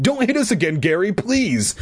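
high pleading whimper. A narrator's reading. Bully with a bloody nose begging, whimpering. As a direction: beg from up high. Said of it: "Carl whimpered behind him".

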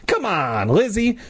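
high-pitched urging call; coaxing cry, rising high. A narrator's reading. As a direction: call out, voice jumping up on the name. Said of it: "Luke cried".

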